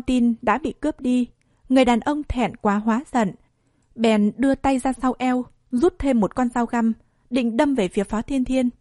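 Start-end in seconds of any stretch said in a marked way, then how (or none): none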